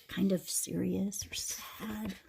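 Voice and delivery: breathy hushed answer; whisper. Real, not acted. Reader whispers the answer to the children. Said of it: "whispered answer to own question".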